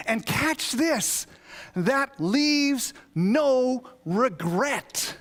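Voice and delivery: high, loud, slow and stretched preaching; preaching at full force. Acted. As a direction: lift the verse up like a proclamation. Preaching a verse with rising force.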